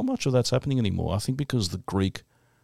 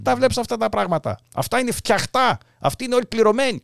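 put-on high, scornful old grandmother voice in Greek; grandma in Greek; 14 semitones higher, 3 dB louder than usual. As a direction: mimic his Greek grandmother scoffing at the footy. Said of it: "And my grandma goes".

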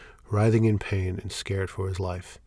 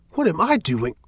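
loud, much higher indignant retort; 9 semitones higher, 6 dB louder than usual. Acted. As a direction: fling the question straight back. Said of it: "Shane retorts".

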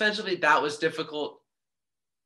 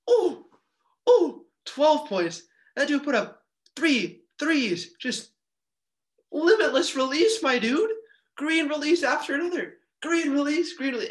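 much higher and louder, laughing with excitement; hyped up; 10 semitones higher, 4 dB louder than usual. Real, not acted. Podcaster hyping up Jakumovski's threes, overjoyed and loud. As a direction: rave like a fan hyping a shooter.

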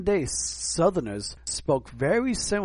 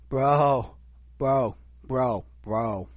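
mocking New York "bro", repeated with pauses; Vince Russo; much slower than usual. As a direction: lightly send up a wrestling personality's bro. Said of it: "make fun of Vince Russo".

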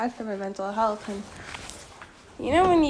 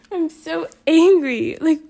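real anger, upset; 6 semitones higher, 8 dB louder than usual. Real, not acted. Upset vlogger venting about a friend, anguished angry wail.

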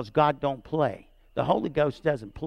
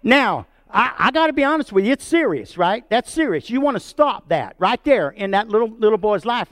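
higher and louder, pressing the point; emphatic preaching; 6 semitones higher, 5 dB louder than usual. Real, not acted. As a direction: drive home how serious it is. Pastor stresses seriousness.

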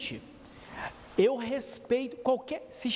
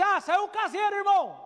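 neighbour calling back; 8 semitones higher, 3 dB louder, faster than usual. Retold or imitated. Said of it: "Eu falei assim".

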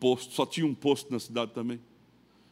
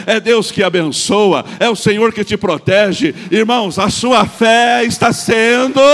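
much louder, higher, slow climax; full-force preaching; 6 semitones higher, 14 dB louder, slower than usual. Acted. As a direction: preach it out to the congregation.

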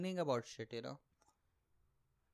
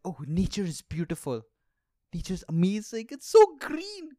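mocking influencer; 10 dB louder than usual. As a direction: ham up a dreamy travel influencer. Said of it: "Saying things like".

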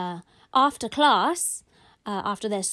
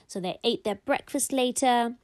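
usual speaking voice

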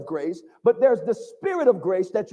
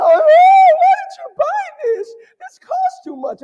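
much higher, louder mock child's cry; imitating grateful kids; 9 dB louder than usual. Preacher voicing kids stunned by Christmas presents, high and loud. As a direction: squeal it like an overwhelmed child.

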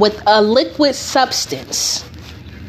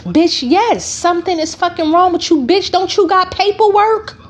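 retold sassy clapback pitched high; incredulous retort; 7 semitones higher than usual. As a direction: snap back with mock amazement. Mocking her mental illness reply.

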